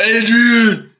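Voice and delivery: high, loud movie boxer calling out; hammed-up cry for his love; Rocky. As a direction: holler the name like a punch-drunk boxer. Mimicking Rocky calling for Adrian.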